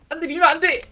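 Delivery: urgent shout. Real, not acted. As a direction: yell don't push. Warning teammate mid-fight.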